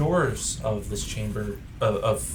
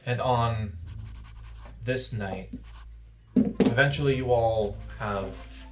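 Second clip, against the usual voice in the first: story narration; 4 dB quieter than usual. A narrator's reading. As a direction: set the scene softly over music. GM narrates the moody scene.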